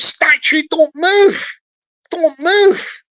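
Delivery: high, loud cry of "don't move"; punchline shout. Acted. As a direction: belt the punchline like a joke reveal. Punchline about the unmoving guard.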